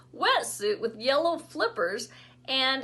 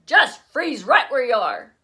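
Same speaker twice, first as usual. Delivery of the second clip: commanding; 8 dB louder than usual. A narrator's reading. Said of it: "Dad said".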